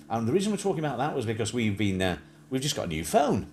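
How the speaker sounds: normal talking voice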